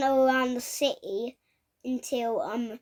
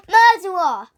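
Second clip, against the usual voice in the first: loud, high mock outcry; crowd accusing; 4 semitones higher, 7 dB louder than usual. Retelling everyone turning on Woody, loud accusing shout. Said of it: "and then everyone went".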